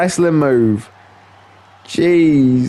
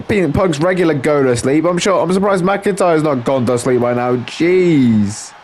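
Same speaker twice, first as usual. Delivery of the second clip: ordinary talk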